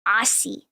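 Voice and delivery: Aussie with an s, "AH-see"; AUSSIE with a hissed s, no z; wrong pronunciation. Shown as a demonstration. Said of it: "not Aussie".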